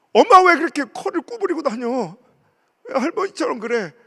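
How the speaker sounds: high, loud scolding of mother; angry outburst. Retold or imitated. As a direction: snap at mom out of hurt. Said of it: "내가 막 화가 나는 거예요". (속상해서 엄마에게 화내듯)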